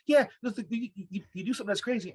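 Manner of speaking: excited, high-pitched Haitian mom; high Haitian-accented impression of mom; strong Haitian accent. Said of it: "My mom has a strong Haitian accent".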